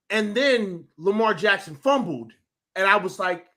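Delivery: ordinary delivery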